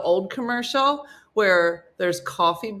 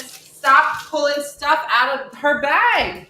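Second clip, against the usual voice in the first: slow scolding; 4 semitones higher, 6 dB louder, slower than usual. Real, not acted. Scolding pet pulling things.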